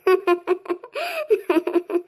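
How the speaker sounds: little laugh breaking through sobs; acted tearful giggle. Daughter coaxed by mother into laughing, tearful giggle. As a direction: giggle weakly while still sniffling. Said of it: "ನನ್ ಮಗಳು ಜಾಣೆ ನಗ್ತಾಳೆ".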